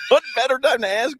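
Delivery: high-pitched disbelieving repeat; incredulous echo. Real, not acted. Co-host challenging the timing of the question, high incredulous echo. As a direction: throw the question back in laughing disbelief.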